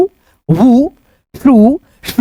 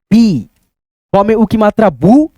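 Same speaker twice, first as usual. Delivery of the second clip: normal talking voice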